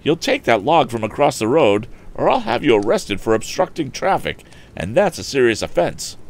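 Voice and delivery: put-on insistent young hero's demand; heated order. Hero orders bullies about.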